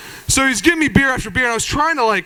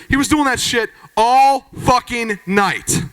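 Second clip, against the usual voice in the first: ordinary delivery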